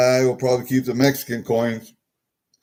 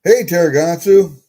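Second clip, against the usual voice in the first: louder call across the room; 6 semitones higher, 8 dB louder than usual. Real, not acted. Calling to Taragatsu across the room, loudly. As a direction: call to get their attention.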